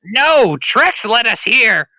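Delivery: high, loud, slow squawk; kobold voice. Acted. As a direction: answer as an agitated little kobold. Kobold answers the question.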